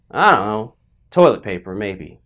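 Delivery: low-pitched casual guess, dropping away at the end; offhand dad. Retold or imitated. Dad answering his horrified child, offhand. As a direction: toss it off as a careless dad.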